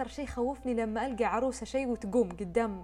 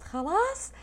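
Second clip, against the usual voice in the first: high, loud, slow complaint; imitating the sister feeling sick; upset sister; 11 semitones higher, 6 dB louder, slower than usual. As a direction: drag out a queasy groan. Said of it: "أختي قالت".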